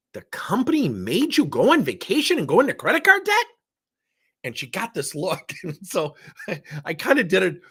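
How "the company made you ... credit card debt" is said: higher, louder, incredulous challenge; theatrical disbelief. Retold or imitated. Coach to struggling employee, theatrical disbelief. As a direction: ham up the disbelief, pushing her to see it. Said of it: "portrayed that with theatrics".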